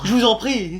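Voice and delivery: ordinary delivery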